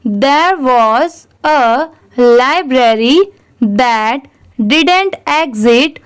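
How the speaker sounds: very slow, each word spaced out; teacher reading a line very slowly for students to repeat; slow read-aloud. Shown as a demonstration. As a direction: read it out slowly so they can repeat after you. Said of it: "आप मेरे बाद ज़रूर repeat करिए".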